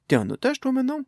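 teasing question rising high; mock surprise. Mia teasing the gnome about being a hostage, mock surprise. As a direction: pretend shock, voice shooting up.

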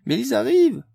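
very high loud alarmed cry; exclaiming. A narrator's reading. Amélie exclaims that the police are coming. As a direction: scream the warning. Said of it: "s'exclama-t-elle à nouveau de plus belle".

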